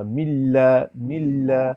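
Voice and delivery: ملا with the لام doubled and firm; firm doubled lam. Shown as a demonstration. Teacher drills the doubled lam. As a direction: say ملا pressing the shadda. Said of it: "سختی سے تھوڑا پڑھیں".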